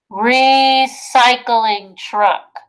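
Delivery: recycling truck read very slowly and loudly. Shown as a demonstration. Teacher reading a word slowly to her class. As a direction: stretch each syllable for the kids. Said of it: "Let's say it".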